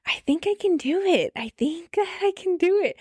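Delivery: high, small, hopeful voice; hopeful self-talk. Retold or imitated. Woman pulled toward going to prom, tentatively hopeful. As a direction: imitate yourself tentatively talking yourself into it.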